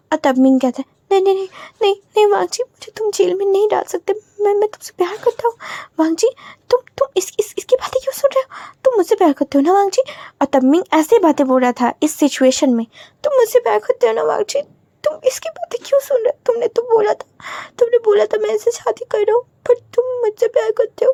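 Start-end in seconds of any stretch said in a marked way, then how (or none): 1.1-10.0 much higher, frantic desperate voice; cornered man pleads with lover to spare him, panicking; panicked pleading. Acted. Desperate denial facing jail. 13.2-21.1 much higher, frantic desperate voice; cornered man pleads with lover to spare him, panicking; panicked pleading. Acted.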